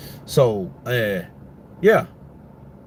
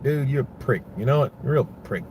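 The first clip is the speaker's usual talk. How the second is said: normal talking voice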